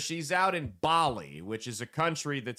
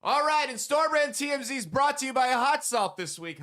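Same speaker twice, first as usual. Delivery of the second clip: ad read; 11 semitones higher than usual. Acted. Opens sponsor read.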